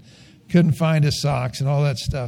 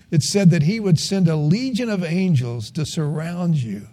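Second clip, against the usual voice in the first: ordinary delivery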